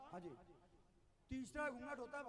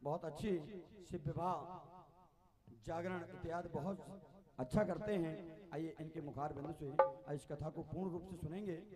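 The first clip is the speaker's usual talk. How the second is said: stage announcement; 5 semitones lower, 11 dB louder than usual. Real, not acted. Announcing guest at jagran.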